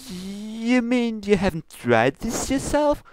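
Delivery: high, formal princess scolding; lofty royal reproach, pitched high. Acted. Luna scolds Pinkie about her ingredients, high and prim.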